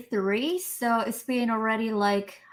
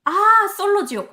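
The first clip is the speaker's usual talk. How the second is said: sudden recognition; 10 semitones higher, 7 dB louder than usual. Real, not acted. Recalling the Korean title.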